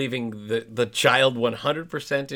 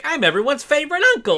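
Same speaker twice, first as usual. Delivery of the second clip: high, loud mock-gushing uncle; hammed-up self-satisfied enthusiasm, quick; Nat, obnoxious uncle; 9 dB louder, faster than usual. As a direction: mimic an obnoxiously keen coach, pitched way up. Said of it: "I find Nat to be a little obnoxious".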